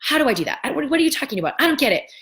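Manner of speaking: high, loud baffled whats; confused protest. Retold or imitated. Viewer baffled by the coach's words, high and flustered. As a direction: sputter in total confusion. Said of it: "So the confusion of".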